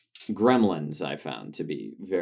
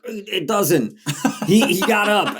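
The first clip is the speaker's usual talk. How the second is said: high, louder retold shock; mock astonishment; 12 semitones higher, 5 dB louder than usual. Co-host retelling slasher twist, high mock astonishment. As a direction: act out a stunned movie twist.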